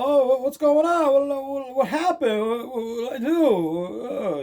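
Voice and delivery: high, stammering mock innocence; playing dumb. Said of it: "you know, playing dumb".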